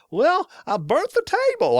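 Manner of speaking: squeaky Australian camp-cook impression; Ruthie imitation. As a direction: mimic a high-pitched Aussie with a grin. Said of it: "voice is really super high pitched".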